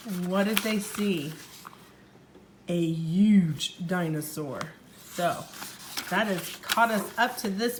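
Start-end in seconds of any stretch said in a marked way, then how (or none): 2.7-4.6 1 dramatic reveal. A narrator's reading.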